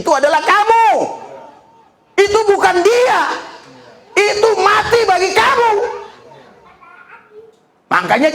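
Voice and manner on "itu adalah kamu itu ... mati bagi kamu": preaching shout. Real, not acted. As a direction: hammer it home to the crowd. Preacher driving the point home.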